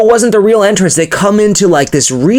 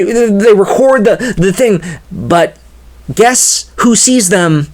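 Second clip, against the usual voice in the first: usual speaking voice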